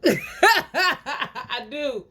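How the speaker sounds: high, laughing admission; can't hold the laugh in; helpless laughter. Real, not acted. Answering someone dead serious, helpless laughter. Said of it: "I can't even hold it in".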